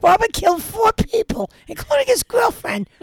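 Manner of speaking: high, loud comic narration; hammed-up, high-pitched narrator; put-on reading voice. Joke reading of a grim script.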